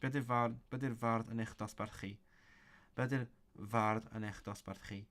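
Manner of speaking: ordinary delivery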